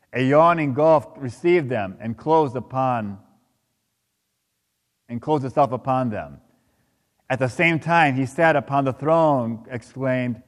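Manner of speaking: ordinary talk, nothing marked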